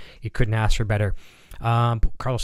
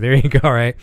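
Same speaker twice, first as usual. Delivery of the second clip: laughing; 3 semitones higher, 8 dB louder than usual. Real, not acted. Amused at viewer's pickup joke.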